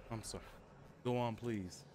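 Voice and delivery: normal talking voice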